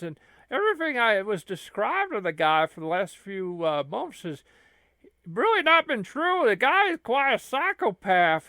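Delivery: a loud, worked-up lackey; Curly Joe voice. Acted.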